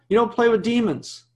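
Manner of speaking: ordinary talk, nothing marked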